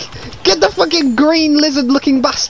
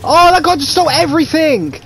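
normal talking voice

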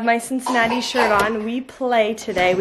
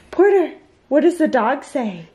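put-on talking to a toddler; 4 semitones higher than usual. Mom quizzing toddler on animals.

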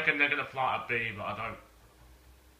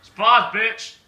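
taunting shout; 9 semitones higher, 13 dB louder, slower than usual. Acted. Taunting after the squeaker trick.

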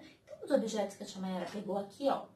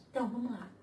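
ordinary talk

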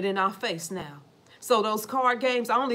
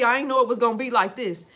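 ordinary delivery

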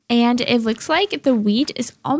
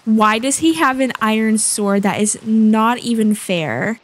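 ordinary talk, nothing marked

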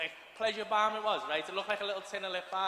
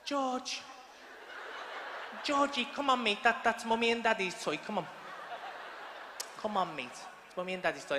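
put-on coaxing child; 3 dB quieter than usual. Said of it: "And I was like".

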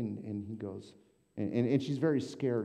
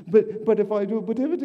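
squeaky voice much higher and louder; high flustered protest; 13 semitones higher, 13 dB louder, faster than usual. Retold or imitated. Patient protesting to her therapist, high and flustered. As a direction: pitch up, flustered and pleading. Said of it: "patient protests".

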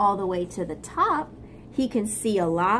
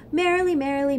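"Merrily, merrily" set higher and a little louder; the first "merrily, merrily" pitched up; higher take; 6 semitones higher, 3 dB louder, much faster than usual. Acted. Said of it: "said the other frog".

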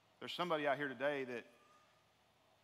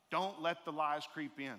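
ordinary talk

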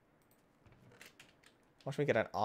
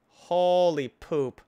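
slow, much louder, stretched exclamation; drawn-out shock; 6 semitones higher, 10 dB louder, much slower than usual. Acted. Player startled at almost dying, in a slow stretched yell. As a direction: drag each word out in mock horror. Nearly dies on a ledge.

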